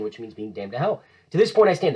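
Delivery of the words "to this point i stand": defiant oath. Acted.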